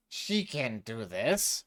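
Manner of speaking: slow, spat-out snooty businessman. Acted. The businessman to Salatra, slow spat contempt. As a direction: spit the words out slowly. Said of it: "Mister Business spat".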